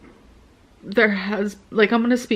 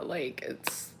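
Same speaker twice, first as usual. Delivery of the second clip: whisper; 7 semitones lower, 17 dB quieter than usual. Real, not acted. Breaking off while confessing hurt.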